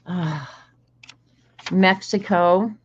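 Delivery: normal talking voice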